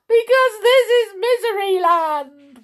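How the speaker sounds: high, loud tearful wail; weeping. A narrator's reading. King explains the rule to visitor, weeping. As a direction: wail it out like a hammed-up crybaby. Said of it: "wept the king".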